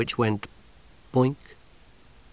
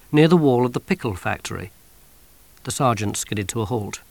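plain reading voice

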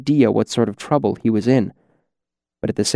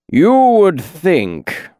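smooth as honey; 7 semitones higher, 4 dB louder, much slower than usual. Acted. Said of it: "rolled out like thick honey".

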